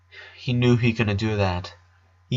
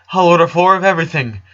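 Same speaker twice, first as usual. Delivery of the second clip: louder, fast, decisive; resolute; 6 semitones higher, 6 dB louder, faster than usual. A narrator's reading. The father decides on lunch for everyone, resolute. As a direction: settle it firmly. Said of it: "Harold said resolutely".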